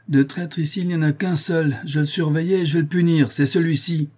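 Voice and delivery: high, emphatic denunciation; accusing. A narrator's reading. Genoese denounces a traitor before crew. (dénonciation accusatrice, voix haute)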